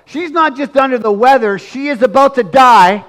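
usual speaking voice

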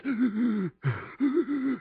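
high gasping sob acted out; demo wailing gasp. Shown as a demonstration. Preacher demonstrating Mary's uncontrolled wailing, gasping sob. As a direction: show the sharp gasp of someone wailing. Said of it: "She was doing this".

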